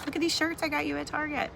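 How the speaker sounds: high, fast excited mom voice. Retold or imitated. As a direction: sell the shirts brightly. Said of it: "You could say".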